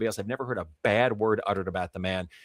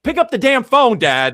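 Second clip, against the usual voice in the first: much higher, much louder mock yell at dad; exasperated yell; 14 semitones higher, 11 dB louder than usual. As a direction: lose it and shout at your stubborn father. Son loses patience with father.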